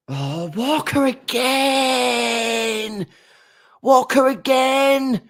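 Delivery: long, high, drawn-out groan of despair; exasperated groan. Real, not acted. Fan groaning at Walker's error, exasperated. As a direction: Walker again, stretched out in disbelief.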